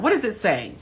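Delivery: normal talking voice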